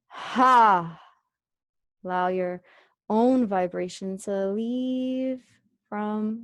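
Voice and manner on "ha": loud open-mouthed "ha" breath out; exhale sigh. Shown as a demonstration. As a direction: push the air out with a voiced ha. Teacher demonstrates an exhale.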